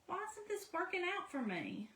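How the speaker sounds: frustrated past self. Retold or imitated. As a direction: mimic her own confused past self. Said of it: "And I'm like".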